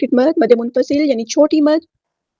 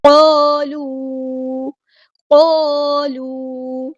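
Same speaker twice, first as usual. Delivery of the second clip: slow, drawn-out melodic tajweed recitation; Quran recitation; much slower than usual. Shown as a demonstration. Teacher recites the verse for students, slow melodic chant. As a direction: chant each word slowly in tajweed.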